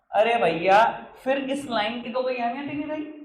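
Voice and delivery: indignant, high-pitched teacher's complaint; exasperated. Real, not acted. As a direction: protest in exasperation, voice rising high. Exasperated over students' wrong entry.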